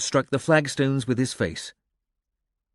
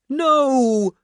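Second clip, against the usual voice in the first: loud, very high anguished howl; 7 dB louder than usual. A narrator's reading. Bram watches the lad stagger and howls in anguish. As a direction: howl it in despair. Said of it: "Bram howled".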